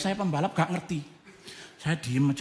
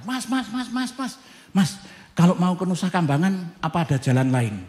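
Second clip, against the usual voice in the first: high repeated calling; chasing a stranger, calling out; 5 semitones higher, 4 dB louder than usual. Retold or imitated. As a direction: call after the rider urgently. Calling after rider again.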